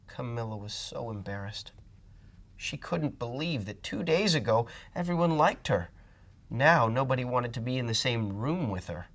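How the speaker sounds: ordinary delivery, nothing marked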